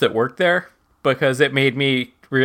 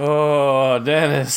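groaning, overfed and stuffed; stuffed full; 3 semitones higher, much slower than usual. Acted. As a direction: sigh out like you ate too much. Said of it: "I ate too much".

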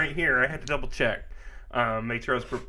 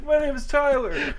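higher, hammed-up redo of his own introduction; mock own intro; 12 semitones higher than usual. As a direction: say your name like the game show kid. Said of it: "in the exact same cadence".

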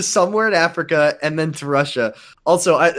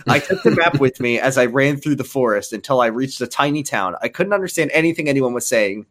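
ordinary delivery

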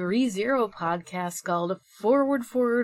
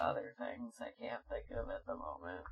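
whisper; 11 semitones lower, 8 dB quieter, faster than usual. Real, not acted. Trailing aside.

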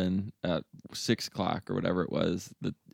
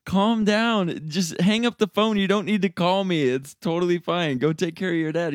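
calming; 8 semitones higher, 3 dB louder than usual. Retold or imitated. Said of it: "And I said".

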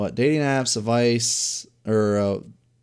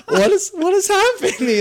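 baffled victim; 5 dB louder than usual. Retold or imitated. Acting confused victim, laughing.

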